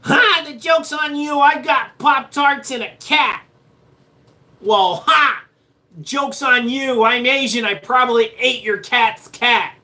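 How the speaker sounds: high, hammed-up character voice. Mock character delivering a boastful joke.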